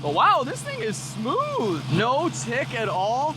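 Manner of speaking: high, drawn-out wonder; amazed delight. Real, not acted. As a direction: stretch the words out in real excitement. Marvels at running engine.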